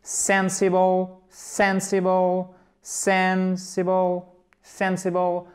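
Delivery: repeat-after-me "sensible", first syllable held long; first-syllable stress drill. Shown as a demonstration. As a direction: drill "sensible" slowly, stretching SEN on a level pitch. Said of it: "Accent tonique sur la première syllabe, chers amis, on le refait ensemble".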